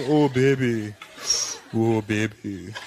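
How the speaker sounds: put-on low lover voice in an African accent; mock-seductive, low-pitched crooning; dealer making love. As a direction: imitate an amorous dealer, deep and drawn out. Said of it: "Imagine he's in there with his woman".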